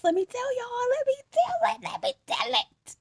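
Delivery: high excited sing-song build-up; excited hype. Real, not acted. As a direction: hype herself up to tell it. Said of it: "hyping herself up to tell story".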